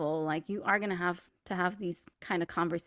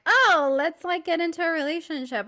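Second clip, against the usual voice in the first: high-pitched, suddenly keen proposal; mock eagerness; 8 semitones higher, 6 dB louder than usual. Friend suddenly suggesting romance after ten years, mock eagerness. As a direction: toss it out as a giddy bright idea, imitated.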